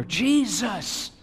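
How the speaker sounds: high call building to a loud yell. A narrator's reading. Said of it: "keeps yelling louder and louder".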